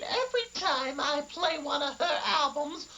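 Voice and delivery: ordinary talk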